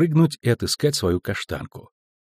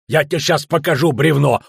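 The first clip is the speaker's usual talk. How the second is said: loud, heated threat; angry threat; 4 semitones higher, 5 dB louder than usual. A narrator's reading. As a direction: threaten her with raised voice. Dwarf threatens girl mid-quarrel. (угрожающе, громко)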